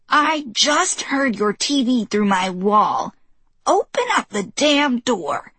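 put-on stern old woman. Old neighbor voiced demanding.

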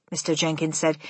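plain reading voice

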